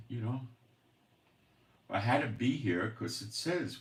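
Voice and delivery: ordinary delivery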